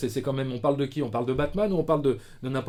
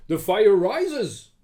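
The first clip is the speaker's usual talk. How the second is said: very slow, loud, raised trailer voice; dramatic title announcement; 5 semitones higher, 5 dB louder, much slower than usual. Acted. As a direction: stretch each word out grandly. Proposes another title in trailer style.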